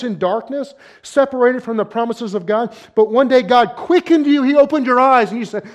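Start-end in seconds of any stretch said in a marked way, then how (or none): 2.9-5.3 rising preaching. Real, not acted. Preacher building to climax.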